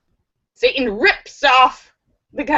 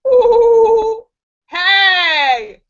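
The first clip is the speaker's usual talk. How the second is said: acted outcry; 8 semitones higher, 6 dB louder, much slower than usual. Joker acts out the spat-on man.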